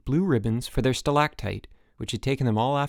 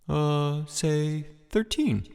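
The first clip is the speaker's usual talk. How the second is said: slow, drawn-out, higher-pitched deliberation; feigned pondering; 4 semitones higher, much slower than usual. A narrator's reading. Rudy picking a table to be it, feigned pondering. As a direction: stretch it out as if choosing at random. Pretends to pick a number.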